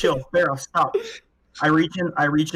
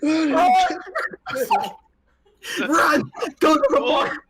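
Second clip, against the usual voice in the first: panicked shouting; 14 semitones higher, slower than usual. Acted. Panicked flight from pursuer.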